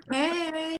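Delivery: high, drawn-out mock hail. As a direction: sing out a comic high hello. Acted hailing of unseen audience.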